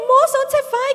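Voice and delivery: high, loud call after someone walking off; calling after her. Retold or imitated. Mother calling after the woman carrying her son, loud and alarmed. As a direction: call out to stop her leaving. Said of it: "Aí eu falei".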